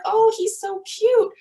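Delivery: high, slow, loud coo; cooing at a baby. Retold or imitated. Cooing at a mom's baby, high and drawn out. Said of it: "you're like".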